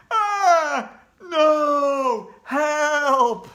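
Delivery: high, loud cry for help; panicked boy yelling for help; horrified cry. Acted. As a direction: scream in horror. Boy forced to kiss bride.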